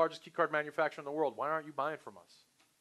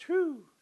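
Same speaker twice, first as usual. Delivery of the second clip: high, loud acted "whew"; sigh of relief; 6 dB louder than usual. Salesperson relieved after a prospect's answer, exaggerated sigh. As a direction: blow out a big mock sigh of relief. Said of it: "breathes a sigh of relief and goes".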